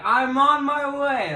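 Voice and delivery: high, loud, drawn-out pilot's shout; hammed-up rescue cry; mock heroic call. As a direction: holler it heroically, stretched out. Voicing Gabe flying to rescue.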